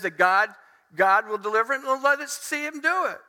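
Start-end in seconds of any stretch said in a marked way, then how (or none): 1.8-3.2 high-pitched taunting challenge; raised, scoffing call; mocking. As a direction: dare him with a jeer. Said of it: "They're mocking him".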